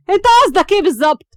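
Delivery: high, loud, fast sharp demand. A narrator's reading. Hams demands what Siraj means, sharp and angry. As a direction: fire the question back indignantly. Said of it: "واتكلمت بحدة".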